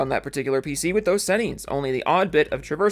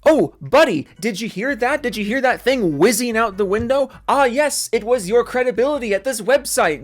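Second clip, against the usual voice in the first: high, loud taunting excitement; mock excited; 8 semitones higher, 6 dB louder than usual. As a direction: gleefully point out the loss.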